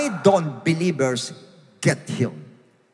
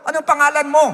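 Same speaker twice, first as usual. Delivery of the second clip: high-pitched imitated asking of God; acting out an anxious question to the Lord; Moses; 8 semitones higher, faster than usual. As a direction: pitch it up, questioning, like a puzzled servant. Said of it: "Yun ang sabi niya sa Lord mismo".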